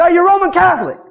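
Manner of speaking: lightly voiced pushy woman; mock interrogator. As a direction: grill them.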